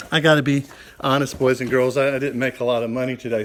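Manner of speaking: ordinary talk, nothing marked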